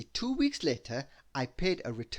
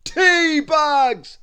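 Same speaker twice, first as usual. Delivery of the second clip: loud, much higher boom; thunderous reply; 12 semitones higher, 15 dB louder than usual. Acted. Giant wizard explaining what powers the teapot, thunderous. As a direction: thunder out the answer proudly. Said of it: "came the thunderous reply".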